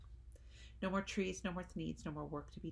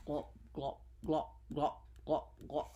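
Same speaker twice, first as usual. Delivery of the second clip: rhythmic mechanical glop sounds; imitated machine glop; 3 semitones lower than usual. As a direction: chug it like a machine. Machine noise words.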